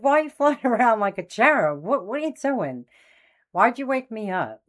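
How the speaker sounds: high, laughing disbelief; laughing at a cherub aunt; laughing question. Retold or imitated. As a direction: ask through a laugh, amazed. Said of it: "So I'm laughing".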